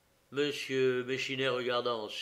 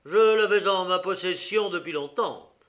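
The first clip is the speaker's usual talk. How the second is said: higher and much louder; defiant answer; 6 semitones higher, 11 dB louder than usual. Acted. Prisoner answers the detective, loud defiant voice.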